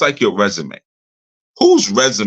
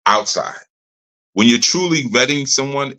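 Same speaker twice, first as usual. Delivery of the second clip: usual speaking voice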